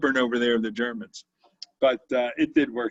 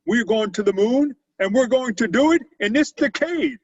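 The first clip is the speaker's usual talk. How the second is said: put-on Kennedy's Boston accent, raised high and loud; JFK Boston accent; 10 semitones higher, 6 dB louder than usual. Retelling Kennedy's moon speech in his Boston accent. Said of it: "but with a Boston accent".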